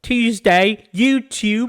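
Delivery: high, loud British caricature; mock British accent. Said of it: "British people talk funny".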